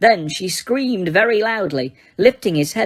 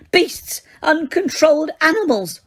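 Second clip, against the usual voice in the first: far higher, frantic insults; hysterical Umbridge; 10 semitones higher than usual. A narrator's reading. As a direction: shriek it hands over head. Umbridge continuing to scream.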